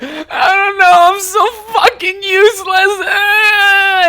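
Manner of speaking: high, loud, drawn-out wailing confession; sob-shriek it through mock hysterics; imitating crying-laughing. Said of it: "in the middle of just crying laughing".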